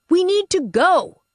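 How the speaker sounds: high, pressing call to leave; urgent Aunt Bee. Acted. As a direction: push them out the door now. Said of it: "Aunt Bee said".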